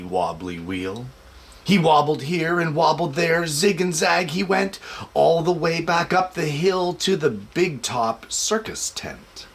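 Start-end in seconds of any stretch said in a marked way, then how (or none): none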